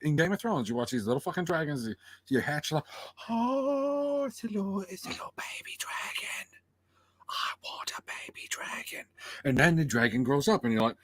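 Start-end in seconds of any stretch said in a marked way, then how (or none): 3.3-9.0 high "oh" melting into a whisper; mock cooing. Imagined viewer dotes on a hatchling dragon, whispered cooing. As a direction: coo in a tiny, adoring whisper.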